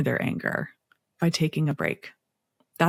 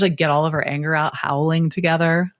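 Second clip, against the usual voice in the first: ordinary talk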